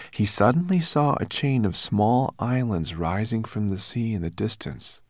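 usual speaking voice

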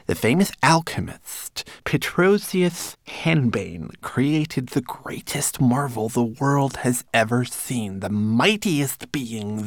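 high, slow, declaimed tale; recited. A narrator's reading. Manikin telling his master's legend, reciting high and slow. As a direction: recite grandly in a small servant voice. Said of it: "Twigleg obediently recited".

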